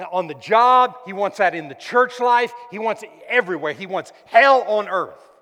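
loud, high-pitched preaching climax; preaching at full force. Acted. Preacher warning the church about the enemy, shouting. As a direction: build it into a full-throated warning.

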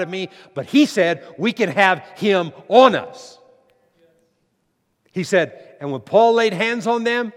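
loud, high-pitched preacher in full flow; fervent preaching. Real, not acted. Preacher proclaiming scripture about the Spirit to his congregation, fervent. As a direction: proclaim it with force, punching key words.

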